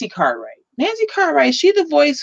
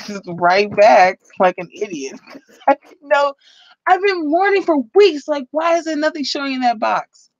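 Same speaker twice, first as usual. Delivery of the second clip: normal talking voice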